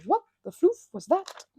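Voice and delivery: startled outburst. Retold or imitated. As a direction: blurt it out like something just went wrong. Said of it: "they have the presence of mind to be able to go".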